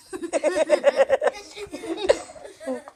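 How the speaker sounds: real laugh. Real, not acted. Storyteller laughs after spell.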